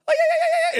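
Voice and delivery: high, loud imitated war cry; rapid-fire shrieked battle yell, imitating Xena; Xena scream. Fan mimics her on hearing that scream, imitated Xena war cry. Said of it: "she would do the Xena scream".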